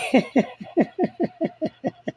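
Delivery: high, staccato peals of laughter; helpless run of high giggles; real laugh. Real, not acted. Joking about Rhonda with feet up and sweet tea, high laughter.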